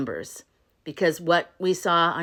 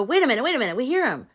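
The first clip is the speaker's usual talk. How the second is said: higher, sing-song interruption; startled realization; 6 semitones higher, much faster than usual. Retold or imitated. Monkeys stopping the mob on hearing voices, startled. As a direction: halt everyone with sudden discovery.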